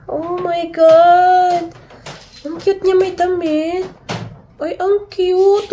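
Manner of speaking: high, slow, stretched-out cooing; gushing over cuteness. Real, not acted. Vlogger gushing at a new toy, high slow coo. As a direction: melt over it, drawing every word out.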